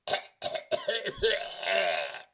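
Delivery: loud, high mock retching cough; hammed-up disgusted coughing fit; sickened coughing. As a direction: cough as if gagging on sappy verse.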